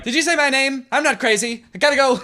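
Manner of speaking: high, hammed-up imitation; mock bully retort. Retelling the bully's weird exit, high mocking imitation. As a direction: mimic her in a high protesting voice. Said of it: "She was just like".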